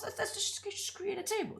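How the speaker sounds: a playful high soft aside; high chirp. Real, not acted. Chirpy aside while clicking.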